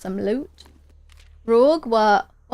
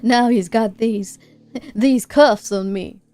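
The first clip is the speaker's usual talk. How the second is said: groggy, halting man in a put-on accent; hammy injured-pilot voice; wounded pilot; 2 semitones higher, 4 dB louder, slower than usual. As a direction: drag the words out, groping for them.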